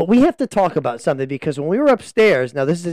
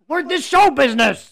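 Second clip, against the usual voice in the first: showbiz prodding; 8 semitones higher than usual. Pretend. Host prods hesitant guest.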